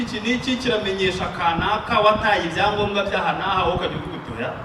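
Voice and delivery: ordinary talk, nothing marked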